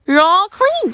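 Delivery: ordinary delivery, nothing marked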